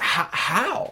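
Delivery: high, strained disbelief barely squeezed out; stunned, breathless how; choked how. Retold or imitated. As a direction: force the words out of a tight throat. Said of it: "all I could muster from my throat".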